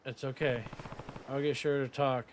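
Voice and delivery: ordinary delivery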